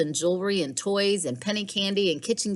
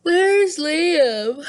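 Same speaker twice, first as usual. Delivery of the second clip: put-on high, louder girl's question; Lily asking; 11 semitones higher, 8 dB louder than usual. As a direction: call out for Liam, eager. Lily asks at breakfast.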